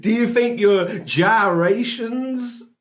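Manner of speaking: high, slow mock question; mocking echo. Reactor mocking interviewer's awkward gyrations question, high and slow. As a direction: parrot the question with disbelief.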